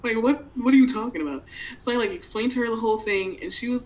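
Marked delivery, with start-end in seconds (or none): 0.0-1.4 shocked disbelief. Retold or imitated. Sister shocked by the story.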